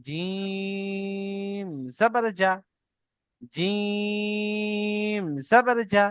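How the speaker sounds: জিম stretched very long, sung out slowly; long drawn jim. Shown as a demonstration. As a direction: draw out জিম for three alif lengths. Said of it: "জিমটি তিন আলিফ টান".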